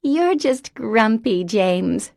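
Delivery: high, slow teasing repeat; childlike teasing. Acted. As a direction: parrot the tease at her brother. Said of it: "she repeated".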